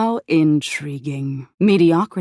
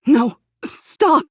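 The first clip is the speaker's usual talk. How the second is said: higher, louder panicked cry of no, stop; terrified scream; 9 semitones higher, 3 dB louder than usual. A narrator's reading. Body falling to pieces, you scream in terror. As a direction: cry out as your body crumbles. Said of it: "you scream".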